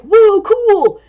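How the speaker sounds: loud, high awed exclaim; amazed squirrel. Acted. Squirrel watching the octopus, loud amazed whoa. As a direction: voice the squirrel's wonder. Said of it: "squirrel's looking kinda like".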